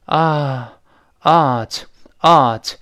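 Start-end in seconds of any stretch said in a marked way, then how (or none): 1.2-2.7 "ought" as "aht", open ah vowel; open-vowelled "aht", t released; American vowel. Shown as a demonstration. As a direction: say "ought" with the ah of a sigh. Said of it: "在美音中又变成了叹气啊".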